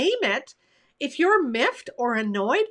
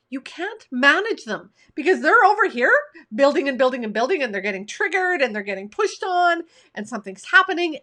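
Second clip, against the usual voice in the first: ordinary talk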